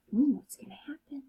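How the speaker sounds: soft whispered suspense; teacher whispering to the class. Real, not acted. Suspense aside to children.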